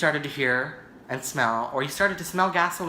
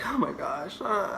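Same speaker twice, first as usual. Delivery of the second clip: retold freak-out; 5 semitones higher, 4 dB quieter, slower than usual. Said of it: "I was freaking out".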